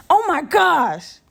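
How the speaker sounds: slow, high, drawn-out amazement; stunned oh my gosh. Real, not acted. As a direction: stretch it out in real disbelief. Still shaken by snake.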